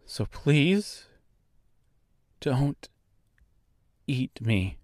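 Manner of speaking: soft, careful pleading; hushed and wary; quiet plea. Acted. Pleading with a huge bird.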